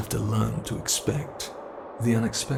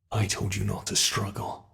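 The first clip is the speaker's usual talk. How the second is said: ordinary talk, nothing marked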